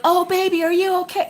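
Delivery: put-on high-pitched gushing baby-talk concern; fussing parent. Imitating most parents fussing over a hurt child, mock concern. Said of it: "where most people have been like".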